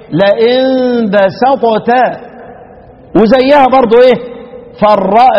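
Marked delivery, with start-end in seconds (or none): 0.1-1.9 لئن بسطت very slow, ط bounced before ت; slow demo with qalqala. Shown as a demonstration. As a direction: let the ط in بسطت echo with a clear bounce. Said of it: "هيبقى إيه".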